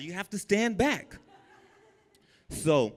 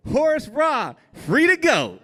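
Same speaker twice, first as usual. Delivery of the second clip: much higher and louder; guard calling the inmate for release; officer calling out; 13 semitones higher, 6 dB louder than usual. Retold or imitated. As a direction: announce the name across the block.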